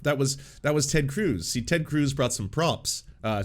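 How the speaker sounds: usual speaking voice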